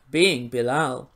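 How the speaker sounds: low, slow mocking drawl of his name; slow sarcastic jab; sneering. Real, not acted. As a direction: drag it out with contempt.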